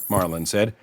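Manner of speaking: usual speaking voice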